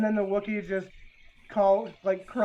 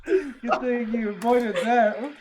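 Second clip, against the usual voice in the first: laughing while teasing, higher; 6 semitones higher, 3 dB louder, slower than usual. Real, not acted. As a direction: tease through laughter.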